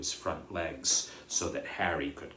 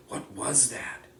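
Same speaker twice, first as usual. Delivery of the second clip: whispered question; 5 semitones higher than usual. Acted. Harry frightened in dark forest.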